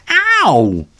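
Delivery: sharp high ow; pained yelp. Acted. Ginger trips in paw ball, high yelp. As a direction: yelp in pain.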